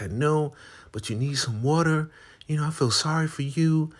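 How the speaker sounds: very high, sugary put-on voice; sweet helper. Woman bringing food to Marva, very high and sweet. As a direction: offer kindly. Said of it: "She's like".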